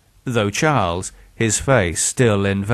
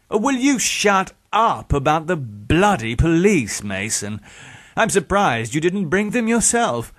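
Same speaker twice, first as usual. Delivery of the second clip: high-pitched, irritated outburst; exasperated villain; 8 semitones higher than usual. A narrator's reading. Villain fed up with talk of police, exasperated. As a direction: scold your victim in exasperation. Villain snaps at begging victim.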